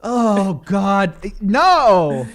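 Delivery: loud, drawn-out groaning wail; mock dismay. Host plays interviewer hearing Trump blamed, mock anguish. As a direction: wail it in hammed-up despair.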